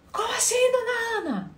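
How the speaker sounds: high, shocked disbelief; shocked question. Retold or imitated. Client shocked reaction. (cliente chocada com a terapeuta, voz aguda de espanto)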